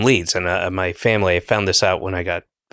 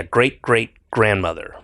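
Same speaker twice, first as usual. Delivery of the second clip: ordinary talk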